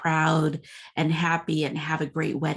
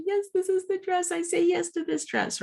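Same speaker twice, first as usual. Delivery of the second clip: tearful joy; 13 semitones higher than usual. Retold or imitated. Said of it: "she was crying and happy".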